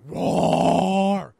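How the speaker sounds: loud hammed-up monster roar; mock dinosaur roar. Describing the B-movie cover's dinosaur, loud mock roar.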